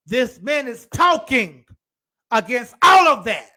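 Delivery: high, pouting complaint rising into a loud shout; whiny sinner building to shout. Acted. Preacher voicing a sinner offended by Jesus, high whiny complaint swelling to a shout.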